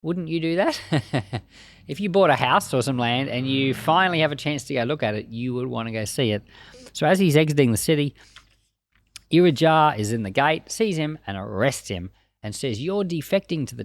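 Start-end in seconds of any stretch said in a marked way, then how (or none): none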